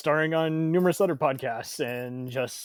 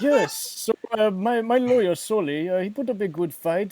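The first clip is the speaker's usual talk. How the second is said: higher, put-on Spanish accent; hammy Spanish-accented celebrity; Antonio Banderas impression; 4 semitones higher, 5 dB louder than usual. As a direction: play a Spanish film star making his case. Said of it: "that Antonio Banderas impression".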